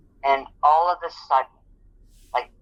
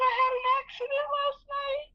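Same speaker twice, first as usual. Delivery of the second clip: much higher, tearful pained voice; crying; 4 dB quieter than usual. Retold or imitated. Injured worker explains last night's accident to her boss, crying. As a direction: sob out the confession. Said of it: "I was crying".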